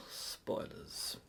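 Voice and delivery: whispered, voiceless "spoilers"; hushed warning. Pretend. Whispers a repeated warning word.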